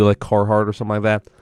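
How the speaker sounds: ordinary talk, nothing marked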